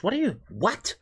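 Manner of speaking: ordinary delivery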